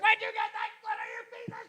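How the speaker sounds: mock angry snarl. Wife snarling at husband.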